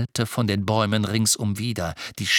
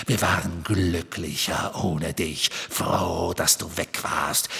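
low, whispery villain voice; cold, sinister low taunting; Voldemort; 6 semitones lower than usual. A narrator's reading. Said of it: "sprach jetzt mit Voldemorts Stimme".

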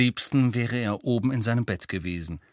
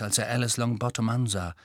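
usual speaking voice

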